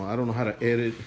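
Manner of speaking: usual speaking voice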